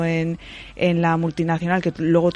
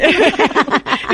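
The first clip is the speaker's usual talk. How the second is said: embarrassed laugh; 8 semitones higher, 6 dB louder than usual. Real, not acted. Embarrassed admitting breathing trouble.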